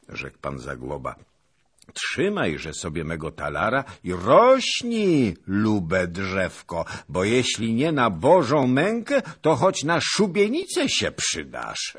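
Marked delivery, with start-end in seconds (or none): 1.9-12.0 loud, high, hammy pronouncement; booming jovial teasing, pitched up; boisterous old noble. A narrator's reading. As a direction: bless the rascal at full voice.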